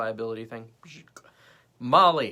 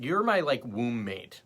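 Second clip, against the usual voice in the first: normal talking voice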